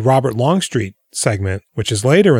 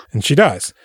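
ordinary talk

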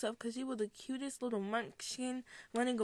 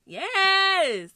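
high, loud, drawn-out cheer; big high-pitched celebratory yell; excited yes; 9 semitones higher, 13 dB louder than usual. Real, not acted. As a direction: belt out a long, excited yes. Excited shout in podcast introduction.

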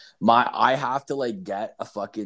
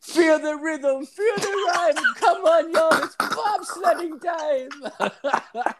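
high, slow Jamaican singsong chant; Jamaican accent; 3 dB louder, slower than usual. Retold or imitated. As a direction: belt the Cool Runnings chant. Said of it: "it's just like".

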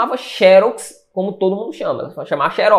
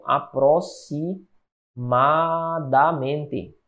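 very slow, softer and lower, stretched out; syllable by syllable; 5 semitones lower, 6 dB quieter, much slower than usual. Shown as a demonstration. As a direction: draw "Aproximadamente" out as if writing it. Word spelled out slowly while writing.

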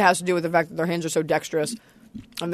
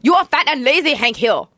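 put-on Laotian accent; 4 semitones higher than usual. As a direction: do the Laotian neighbor from King of the Hill. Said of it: "the Hank King of the Hill goes like".

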